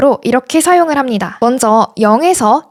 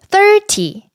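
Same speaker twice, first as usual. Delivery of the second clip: "thirty" with THIR high and stressed; stress on first syllable; 10 semitones higher than usual. Shown as a demonstration. Teacher modelling tens for learners, first-syllable stress. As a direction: hit the front of "thirty". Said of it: "앞에 강조를 주셔야 돼요".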